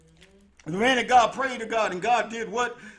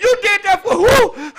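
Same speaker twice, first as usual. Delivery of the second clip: high, loud astonished question; auntie surprised; 11 semitones higher, 10 dB louder than usual. Retold or imitated. Auntie shocked to hear fight was for Wayne, loud and high. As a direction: stun her with the answer. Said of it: "She said".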